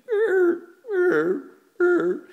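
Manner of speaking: high, loud honking imitation of a goose; goose honks. Mimicking the geese outside, loud high honks. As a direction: honk like a goose. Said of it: "he's like".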